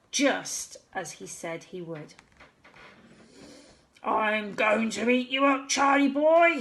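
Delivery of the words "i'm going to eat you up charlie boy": beastly call, building louder; a monster calling up to the boy. A narrator's reading. Monster calling to Charlie, getting louder. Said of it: "he called".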